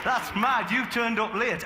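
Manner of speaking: usual speaking voice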